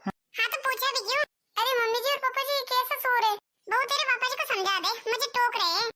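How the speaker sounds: put-on a quarrelsome older wife talking back; bickering mother-in-law. Mother-in-law arguing with husband. (ऊँची आवाज़ में झगड़ालू सास)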